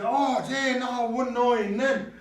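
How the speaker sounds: high, playful "oh gee" dismay; mock exclamation. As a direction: sound scandalized, but play it light. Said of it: "You say, you say".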